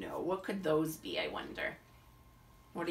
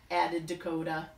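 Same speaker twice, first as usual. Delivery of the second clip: usual speaking voice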